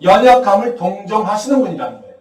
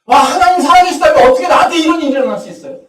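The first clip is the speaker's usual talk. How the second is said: normal talking voice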